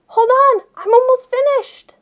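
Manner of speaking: put-on excited kid pleading to keep reading; eager plea. A narrator's reading. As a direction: call back pleading to finish the chapter.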